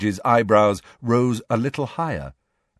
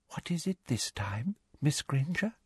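soft hushed question; whispering; 6 semitones higher, 9 dB quieter than usual. A narrator's reading. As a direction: whisper it close, for her alone.

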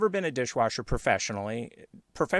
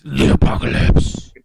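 loud, slow, voiceless rasp; mock-epic roar; 14 dB louder, slower than usual. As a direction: roar it like an over-the-top trailer sting. Imitating the loud apocalypse audio clip.

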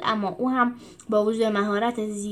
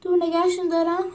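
exhausted wail; 6 semitones higher than usual. Acted. Cornelius wailing to the others mid-battle, tired whine. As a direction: whimper like he can't hold on. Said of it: "با خستگی زار زد".

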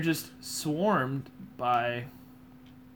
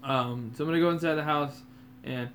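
ordinary delivery

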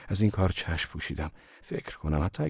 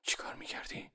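hushed whisper; whispered question in the dark; softly asked; 13 dB quieter than usual. A narrator's reading. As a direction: breathe the question quietly to her. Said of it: "آرام از ماری پرسیدم".

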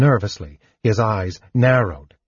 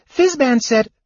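high, louder kender protest; crying out; 14 semitones higher, 3 dB louder than usual. A narrator's reading. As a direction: cry out in worried protest. Tas keeps protesting.

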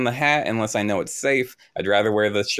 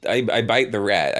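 ordinary talk, nothing marked

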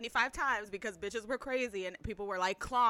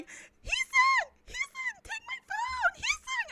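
extremely high, squealed pleading; mock desperate fangirl; slower than usual. Girl climbing over people to reach idol, mock squeal. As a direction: squeal it as a frantic fan.

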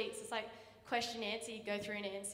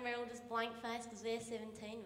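normal talking voice

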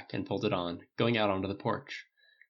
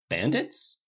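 bewildered, rising question; puzzled; 2 semitones higher than usual. A narrator's reading. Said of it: "Dale said aloud".

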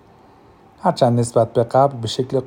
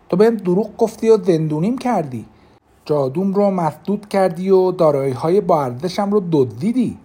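defiant anger; 8 semitones higher, 5 dB louder than usual. Acted. Defiant accusation of traitor.

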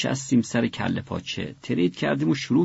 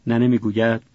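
plain reading voice

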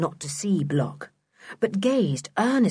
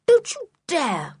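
high, sharp protest; indignant retort; 10 semitones higher than usual. A narrator's reading. George stopping Block stoning the dog, indignant. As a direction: forbid it at once, indignant. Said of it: "said George immediately".

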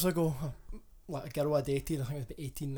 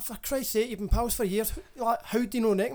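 higher-pitched mock East Coast Scottish voice; imitated East Coast accent; 7 semitones higher than usual. Old girlfriend on the phone, imitated East Coast accent. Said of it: "now you talk like an East Coaster".

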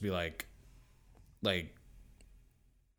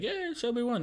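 much higher mock announcer voice; game announcer. Mario Party game announcing the winner, mock cheery. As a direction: sing out the game's verdict. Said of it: "it'll say, like".

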